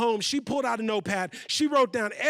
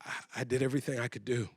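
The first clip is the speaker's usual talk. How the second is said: very quiet, low and halting; despairing; 11 semitones lower, 9 dB quieter than usual. Acted. Mother thinking to herself she has failed, hushed despair. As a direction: barely get it out, with long defeated pauses.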